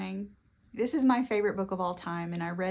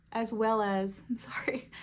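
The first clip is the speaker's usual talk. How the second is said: laughing whisper. Real, not acted. Laughs at herself mid-review.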